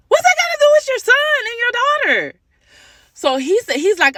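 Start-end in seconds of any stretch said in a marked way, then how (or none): 0.1-2.3 much higher, fast, disbelieving; incredulous. Retold or imitated. Mother to the father on the phone, high incredulous rush. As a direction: squeak out the disbelief.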